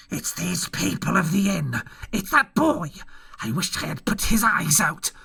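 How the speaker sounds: a touch of rage from the blind man; angry cry. A narrator's reading. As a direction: spit out his spite at the boy. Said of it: "cried the blind man".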